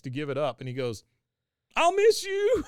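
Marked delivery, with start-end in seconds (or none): 1.8-2.6 loud, very high hammed-up wail; mock crying. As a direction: bawl a farewell like a heartbroken boy. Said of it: "He's just crying".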